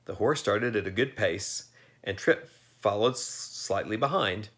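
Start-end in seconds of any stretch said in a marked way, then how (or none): none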